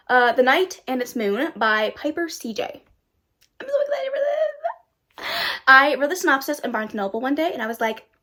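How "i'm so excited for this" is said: very high, slow, fake-sobbing wail; mock cry. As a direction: wail it like a pretend cry, dragged out. Repeats line in a wailing voice.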